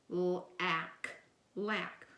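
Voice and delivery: lack with short a, said twice; short a sound. Shown as a demonstration. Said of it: "L A C".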